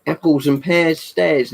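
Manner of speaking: slow, high-pitched mock Cockney; Cockney accent. Shown as a demonstration. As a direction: stretch it out in Cockney. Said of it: "I probably can't do Cockney".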